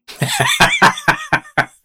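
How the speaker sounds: a sudden high burst of laughter at a grim joke; real laugh. Real, not acted. Doctor's grim autopsy joke.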